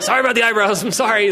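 high-pitched dog apology; apologetic dog. Retold or imitated. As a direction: play a contrite dog who takes it seriously. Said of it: "He's like".

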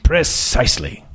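put-on upper-class prep school and British accent; lofty aristocrat sizing up a young woman; aristocratic accent. Magazine boss greeting a job candidate, aristocratic accent. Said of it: "His accent was patently aristocratic".

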